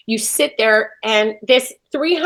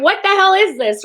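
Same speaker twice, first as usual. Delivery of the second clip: higher-pitched mock disbelief; retold alarm; 8 semitones higher than usual. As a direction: act out being startled by a giant eagle. Retold startled reaction to eagle.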